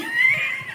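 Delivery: high-pitched wordless laughing fit; real laugh. Real, not acted. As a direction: crack up helplessly at the pet. Laughing at the dog's look. (matinis na tawa)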